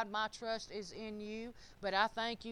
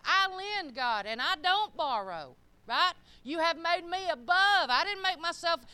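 bold confession; 5 semitones higher, 4 dB louder than usual. Shown as a demonstration. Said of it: "You need to be saying the same thing".

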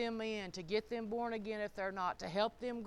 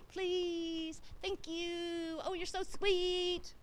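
higher, syrupy put-on sweetness; mock sugary sweetness; 7 semitones higher, much slower than usual. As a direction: coat each word in fake sugar, a bit slow. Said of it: "everything I said just had to be wrapped in".

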